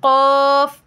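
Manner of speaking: drawn-out, heavy q in "क़ाफ़"; model letter qaf. Shown as a demonstration. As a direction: sound out "क़ाफ़" from the back of the tongue. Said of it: "क्या है?".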